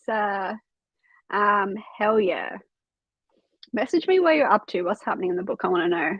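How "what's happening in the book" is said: normal talking voice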